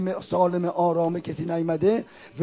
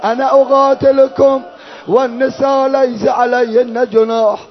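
drawn-out Arabic lamentation chant; mourning recitation; 4 semitones higher, 8 dB louder, slower than usual. Acted. Reciter telling mourners of Hussein's challenge, mourning recitation. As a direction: recite slowly in a ringing, sustained tone.

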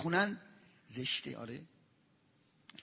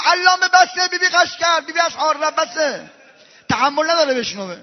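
higher, louder urgent stop; urgent plea; 4 semitones higher, 5 dB louder than usual. Acted. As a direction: beg him to stop, alarmed. Angel urgently stops the recital.

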